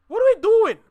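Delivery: loud, high exasperated yell; high-pitched cry of frustration. Real, not acted. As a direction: shout it in disbelief at your own people. Ranting at fellow Canadians.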